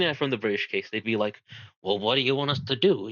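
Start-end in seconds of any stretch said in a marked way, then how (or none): 1.8-3.1 put-on a quick, accidental Italian accent on "Well, what do you want us to do?"; a light, unplanned Italian-accent impression. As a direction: toss the line off in a sudden mock Italian accent. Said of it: "why did I do an Italian accent?".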